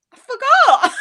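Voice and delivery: loud, high blurting out; real frustrated shout; exasperated outburst. Retold or imitated. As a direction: yell the blooper punchline. Retold podcast blooper punchline.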